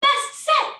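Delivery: high, loud chant reply; shouted response. Shown as a demonstration. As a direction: call it out like a cheer. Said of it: "When I say last set, you say".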